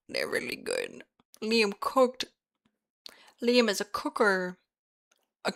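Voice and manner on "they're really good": whispered. Acted. Repeats praise whispered.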